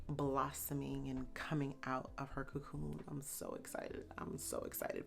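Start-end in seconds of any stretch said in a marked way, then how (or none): 3.1-4.0 soft excited. Real, not acted. First of two excited takes. 4.2-5.1 excited whisper. Real, not acted. Repeats line as a whisper.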